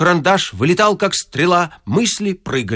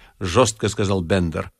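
usual speaking voice

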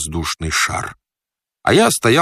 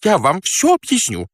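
high-pitched, agitated promise; flustered fat man; 10 semitones higher than usual. Acted. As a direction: insist in a squeaky raised voice. Stranded fat man promises to explain. (взволнованный толстяк, высоким голосом)

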